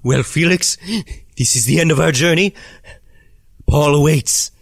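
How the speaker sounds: solemn guide voice. Acted. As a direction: present the end of the road gravely. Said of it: "he just says".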